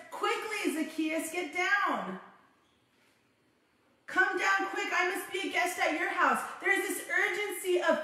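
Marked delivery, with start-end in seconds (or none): 0.1-6.4 urgent call. Acted. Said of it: "Jesus says".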